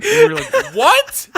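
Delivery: laughing. Real, not acted. Reacting to absurd story detail.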